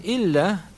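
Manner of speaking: illa drawn out with a high hanging rise; suspenseful illa. Shown as a demonstration. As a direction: leave "illa" hanging high, as if an excuse is coming. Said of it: "So when you hear illa".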